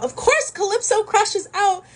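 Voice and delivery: high, drawn-out exclamation; exasperated escalation. Real, not acted. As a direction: build to a slow, high-pitched outburst. Narrator escalates the repeated line.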